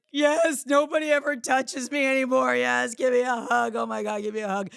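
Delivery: loud, high, overjoyed yes to a hug; retold gushing acceptance of a stranger's hug; desperate plea. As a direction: blurt it out, starved for touch. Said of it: "I was like".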